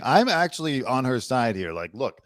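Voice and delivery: ordinary delivery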